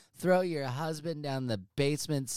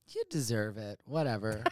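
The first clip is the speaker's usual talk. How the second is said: normal talking voice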